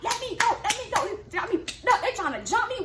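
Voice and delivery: high, agitated protest at talking volume; retold wild anger. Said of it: "I'm mad. I'm wild".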